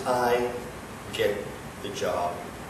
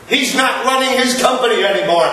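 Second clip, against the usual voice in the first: louder and higher, building to a yell; rising anger; 6 semitones higher, 4 dB louder than usual. Acted. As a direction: drive it home harder and harder. Boss berates employee over father.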